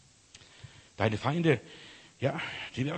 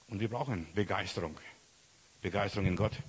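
normal talking voice

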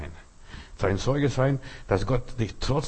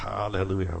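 normal talking voice

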